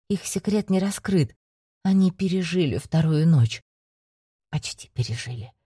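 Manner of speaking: ordinary delivery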